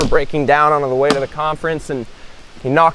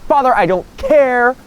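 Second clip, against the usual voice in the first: high, exasperated plea; desperate insistence; 9 semitones higher than usual. Acted. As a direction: blurt it out at the end of patience. Desperate guy confronts the monk.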